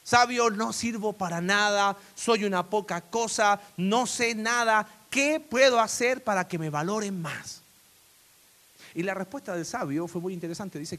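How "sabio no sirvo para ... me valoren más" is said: nearly crying. Acted. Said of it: "casi llorando".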